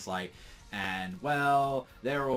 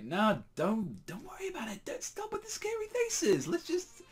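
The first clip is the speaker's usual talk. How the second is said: far higher, mock-soothing voice; mocking elf villain; 11 semitones higher than usual. Elf villain telling the frightened knights to relax, high mocking coo. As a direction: coo at them like they are silly children.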